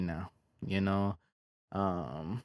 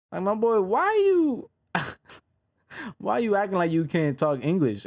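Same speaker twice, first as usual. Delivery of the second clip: incredulous; 9 semitones higher than usual. Real, not acted. Mock disbelief at fighter.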